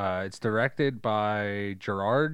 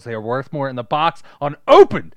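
fast, louder protest rising to a yell; hammed-up collector's panic, getting much louder; mock outrage; 3 semitones higher, 11 dB louder than usual. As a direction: yell like a collector watching toys get opened. Ranting at the movie scene.

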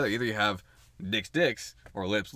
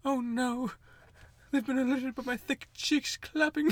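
high, slow cartoon bear; Winnie the Pooh; 13 semitones higher, slower than usual. Bear worrying he's been heard, high slow impression. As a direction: fret slowly in a high storybook bear voice. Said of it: "I'm gonna do my poo impression".

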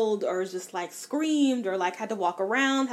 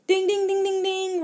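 high, loud ringing dings like a buzzer; ding it out like a quiz-show bell; game show ding; 11 semitones higher, 6 dB louder than usual. Acted. Announcing the author she will never read again with loud, high dings.

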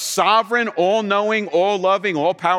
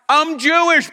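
louder punchline; 9 semitones higher, 5 dB louder than usual. Acted. Paul's punchline, loud.

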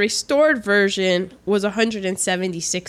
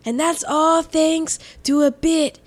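James Mason; 3 semitones higher than usual. Acted. Answering co-host about his accent in a James Mason impression. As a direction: put on the actor's stretched, stylised speech. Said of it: "how to talk like James Mason".

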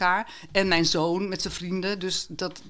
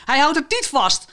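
higher, louder indignant cry; indignant outcry; 8 semitones higher, 5 dB louder than usual. Acted. Dylan objecting to the painting, indignant higher outcry. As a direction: protest loudly like an offended teenager. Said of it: "riep Dylan verontwaardigd uit".

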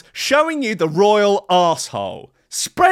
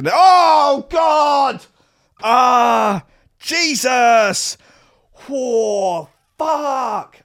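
louder, higher shocked shouts; mock horrified shout; 4 semitones higher, 4 dB louder, much slower than usual. Viewer seeing the royal anus, hammed-up horrified shouts. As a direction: recoil in mock horror. Reacting in horror to screen.

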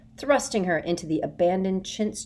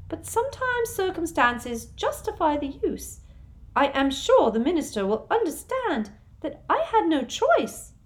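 high, prim, slowing on the last words; a sugary official feigning regret; Umbridge voice; 11 semitones higher, 4 dB louder than usual. A narrator's reading. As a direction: Umbridge blaming Harry. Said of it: "Umbridge justifying herself".

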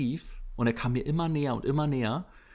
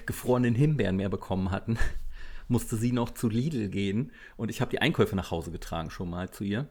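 ordinary talk, nothing marked

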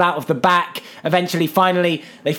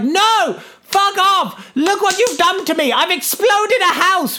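high, hammed-up indignant yell; mock fury at being wronged; outraged retort; 13 semitones higher, slower than usual. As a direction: act out an exasperated outburst. Character's angry retort in retelling.

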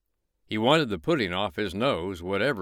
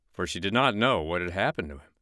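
ordinary delivery

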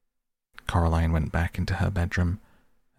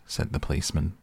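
ordinary delivery, nothing marked

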